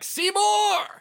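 high-pitched acted yell; yelling back. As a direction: yell the name back at your enemy. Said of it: "Titus just yells back".

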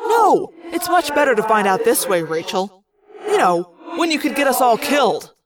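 pointed, biting irony; sarcastic. A narrator's reading. Jake scolding Rachel over hidden problem, sarcastic. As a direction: lay on the sarcasm as a reproach. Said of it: "It's not like Jake to be sarcastic".

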